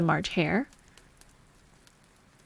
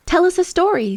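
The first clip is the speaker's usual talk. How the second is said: eager demand; 11 semitones higher, 5 dB louder than usual. A narrator's reading. March Hare demands a story.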